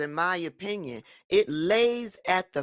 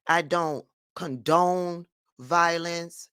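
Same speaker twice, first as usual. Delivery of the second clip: ordinary delivery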